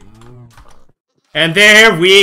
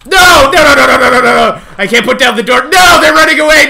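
loud, high panicked shouting; 4 semitones higher, 4 dB louder than usual. Real, not acted. Streamer yelling as pigs run away, panicked shouts. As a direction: cry out as the pigs flee. Animals escape as door fails.

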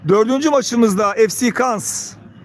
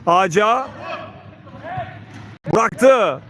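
normal talking voice

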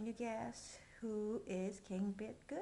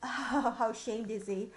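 ordinary delivery, nothing marked

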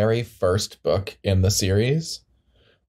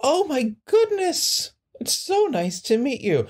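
very high, drawn-out delight; gushing greeting. Acted. Eddie Rue meeting the new district attorney, gushing. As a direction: gush in a high, swooping voice.

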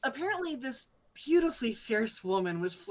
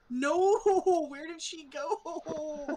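high, drawn-out comic wail; hammed-up regretful cry; mock dismay; 8 semitones higher, much slower than usual. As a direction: stretch it into a pretend lament, laughing. Retelling belated realisation of flirting.

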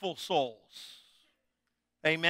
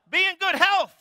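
much higher and louder, ringing it out; loud emphatic answer; 11 semitones higher, 7 dB louder than usual. Real, not acted. Preacher answers his own question to the congregation, loud. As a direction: proclaim it to the class. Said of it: "what does it say, class?".

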